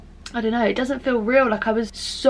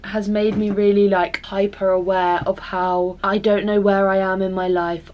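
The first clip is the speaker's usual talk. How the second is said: ordinary delivery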